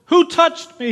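very high, loud sudden question; Jesus in the crowd, startled and sharp; surprised question. Acted.

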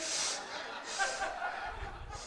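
high, airy laughter; breathless laugh. Real, not acted. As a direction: laugh silently at the joke. Laughs at his own joke.

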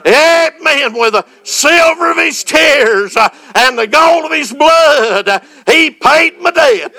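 preaching. Acted. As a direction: preach it out with full fire. Sermon climax, shouted praise.

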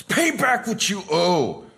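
high, harsh demand to pay up; angry demand. Retold or imitated. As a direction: choke out the demand like a furious creditor. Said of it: "picture an angry person".

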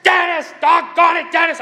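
high, loud exasperated yell of a name; hammed-up cussing shout; mock angry yelling. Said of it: "unless they're actually yelling at me".

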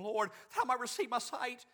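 high, earnest plea; pleading blind man. Acted. Blind man asking Jesus for his sight, pleading high. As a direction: lift the voice in a humble request. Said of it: "The blind man said to him".

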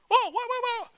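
high, loud yelping protest; panicked cry. Acted. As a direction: Simeon crying out as he's seized. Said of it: "I can hear Simeon crying".

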